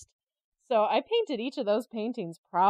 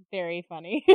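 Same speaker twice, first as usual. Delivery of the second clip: ordinary delivery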